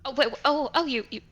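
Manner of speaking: put-on startled, rising confused girl voice; a hint of a dazed girl; confused young girl. Plays confused Hellebore.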